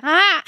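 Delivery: a broken, cracking squeak; cracking voice croak. Retold or imitated. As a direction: let the voice crack on a yelp. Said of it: "a crack".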